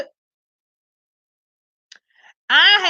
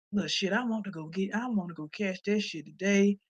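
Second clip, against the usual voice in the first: scheming aside; 6 semitones lower, 13 dB quieter than usual. Pretend. Voicing the scheming wife.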